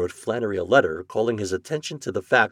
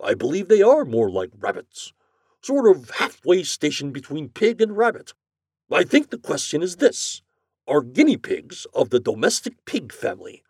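higher-pitched, authoritative deliberation; pondering company president; 7 semitones higher, 4 dB louder than usual. A narrator's reading. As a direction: think it through, then settle it.

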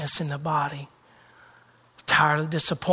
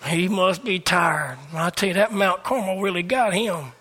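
usual speaking voice

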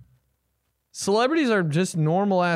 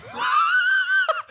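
falsetto laugh. Real, not acted.

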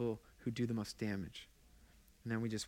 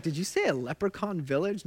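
puzzled listener; 5 semitones higher, 4 dB louder than usual. Retold or imitated. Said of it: "Somebody's like".

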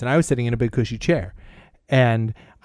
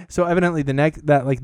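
usual speaking voice

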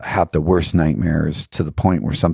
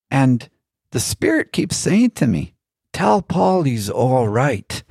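put-on Irish accent; 8 semitones higher than usual. Old Irish man passing on a spirit's message, in a high Irish accent. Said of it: "I'm not doing a very good Irish accent".

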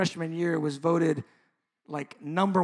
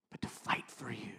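hushed whisper, lower; 7 semitones lower, 8 dB quieter than usual. Real, not acted. Pastor pleading softly with his congregation, whispered. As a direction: breathe it out as a secret plea. Intimate plea in sermon.

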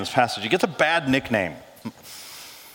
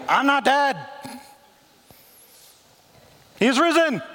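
proclaiming Jesus; 10 semitones higher than usual. Acted. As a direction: announce it with rising triumph. Jesus voiced in retelling.

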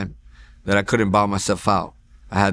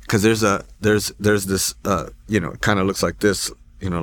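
ordinary delivery

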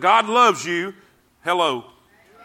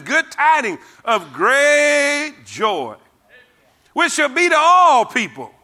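proclaiming; 7 semitones higher, 4 dB louder, slower than usual. Acted. Preacher quoting the angel again.

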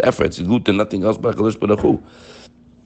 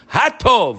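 "Hatov" called out high, with an exclamation point; 13 semitones higher than usual. Shown as a demonstration. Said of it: "with an exclamation point".

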